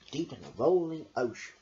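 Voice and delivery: usual speaking voice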